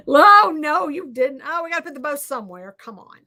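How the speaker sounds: usual speaking voice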